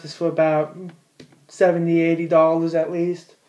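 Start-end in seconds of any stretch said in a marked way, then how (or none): none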